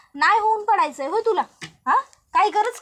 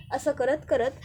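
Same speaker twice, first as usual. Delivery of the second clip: ordinary delivery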